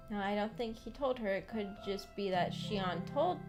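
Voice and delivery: normal talking voice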